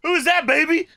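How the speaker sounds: high-pitched imitation of a wrestler yelling from the background; mock yell. Imitating Booker heard from the background, high mock yell. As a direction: call out high, hamming up Booker. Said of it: "you can hear Booker yell".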